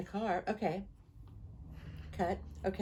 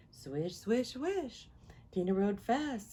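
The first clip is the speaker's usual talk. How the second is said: usual speaking voice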